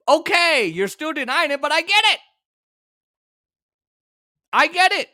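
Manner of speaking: high, loud mock agreement; sarcastic concession. Real, not acted. Host mocking the deniers' excuse, sarcastic and high. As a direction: give in with a loud sneer.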